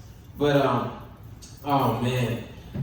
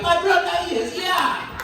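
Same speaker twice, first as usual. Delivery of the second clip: welcoming Africans; 10 semitones higher than usual. Retold or imitated. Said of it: "the Africans looked at me and was like".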